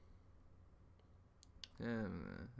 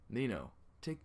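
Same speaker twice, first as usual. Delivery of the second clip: ordinary delivery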